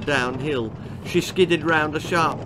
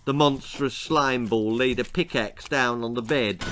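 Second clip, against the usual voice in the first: plain reading voice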